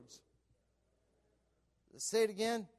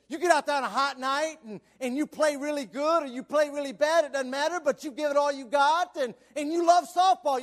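louder, higher pulpit delivery; fervent preaching; 8 semitones higher, 8 dB louder than usual. Real, not acted. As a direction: drive it forward with rising fervor. Preaches with force.

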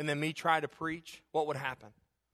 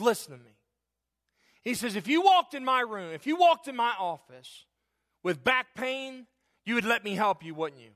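doctor rebukes him, higher and louder; firm rebuke; 6 semitones higher, 7 dB louder than usual. Retold or imitated. Doctor rebuking his crying patient, loud and firm. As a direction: press it home like a caring doctor. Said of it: "He rebuked me in Jesus' name".

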